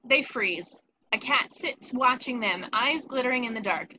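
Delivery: ordinary delivery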